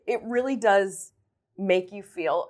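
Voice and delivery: ordinary talk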